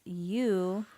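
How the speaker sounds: normal talking voice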